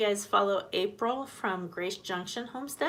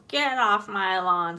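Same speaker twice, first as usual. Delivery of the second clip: put-on slow, louder cranky old man bark; grumpy old man; 8 dB louder, slower than usual. Voicing the drake as a cranky old man, slow and loud. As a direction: play the grouchy old duck guarding his turf. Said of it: "it sounds like he's going".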